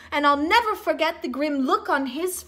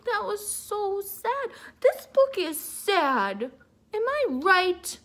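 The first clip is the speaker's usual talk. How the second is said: plain reading voice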